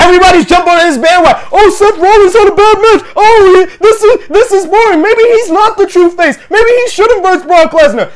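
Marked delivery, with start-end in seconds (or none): 1.5-8.1 high-pitched mock fan whine; mocking imitation of griping fans; mocking complainers. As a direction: parrot the naysayers in a raised voice. Mocking what everybody says.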